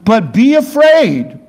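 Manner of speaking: high, slow, loud preaching read; scripture reading. Acted. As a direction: proclaim the verses slowly and high.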